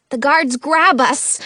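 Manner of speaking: plain reading voice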